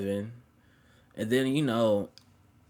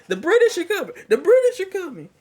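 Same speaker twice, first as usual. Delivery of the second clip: very high, loud mock alarm cry; Paul Revere; 7 dB louder than usual. Warning that her parents are coming, as a shrill mock herald. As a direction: sound the alarm like a comic herald. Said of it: "I gotta become like Paul Revere".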